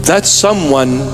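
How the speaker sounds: ordinary talk, nothing marked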